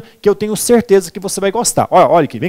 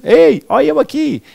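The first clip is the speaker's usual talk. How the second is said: higher-pitched mock Minas Gerais call; sing-song Minas Gerais accent, pitched up; mineirinho accent; 7 semitones higher than usual. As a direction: imitate a friendly Mineiro calling out. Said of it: "o pessoal fala mesmo".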